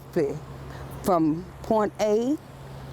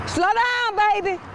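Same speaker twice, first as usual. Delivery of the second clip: high, loud call to a passing car; 10 semitones higher, 3 dB louder than usual. Real, not acted. Crossing guard calling to a speeding driver, loud call. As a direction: sing it out to the driver.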